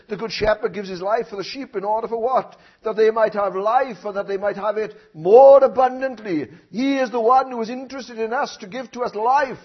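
fervent sermon voice; preaching. Acted. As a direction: lift it up to the church. Preaching swell.

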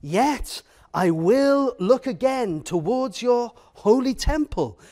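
a preacher marvelling at the promise; awed reading. Shown as a demonstration. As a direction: lift the hopeful line up with awe. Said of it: "he says an amazing word".

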